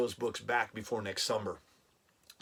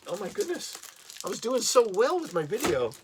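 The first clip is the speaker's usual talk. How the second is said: much higher, louder flustered whine; flustered panic; 9 semitones higher, 5 dB louder than usual. Real, not acted. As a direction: panic that the video is ruined. Loses his cheat sheet on camera.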